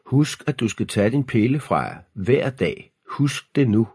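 mild shout, pitched high; shouted reminder. A narrator's reading. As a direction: call it out as a parting reminder. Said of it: "Så råbte han". (råbende formaning fra far)

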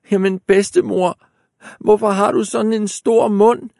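put-on high frightened girl. A narrator's reading. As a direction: cry it out in alarm.

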